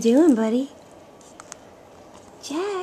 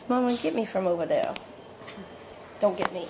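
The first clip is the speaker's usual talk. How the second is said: lightly voiced baby, faster; voicing the baby; 7 semitones lower, 11 dB quieter, much faster than usual. Dad speaking for baby to mom, lightly put-on baby voice.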